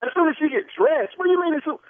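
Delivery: high, shocked outburst; can't believe what he just heard; outraged disbelief. Real, not acted. Husband hears his wife must get dressed, shocked and outraged. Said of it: "You too irate".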